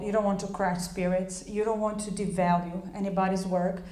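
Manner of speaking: ordinary talk, nothing marked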